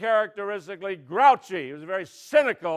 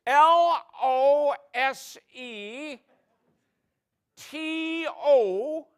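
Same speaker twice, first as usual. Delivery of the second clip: slow, higher, letter by letter; contestant spelling; 5 semitones higher, much slower than usual. Pretend. Contestant spelling the punchline, slow and higher. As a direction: drag each letter out.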